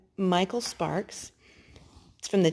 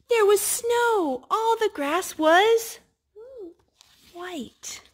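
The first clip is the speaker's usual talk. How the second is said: higher, slower, amazed reading; surprised storyteller; 13 semitones higher, 3 dB louder, much slower than usual. Acted. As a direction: reveal the snow with wonder to a child. Skunk's surprise at the snow.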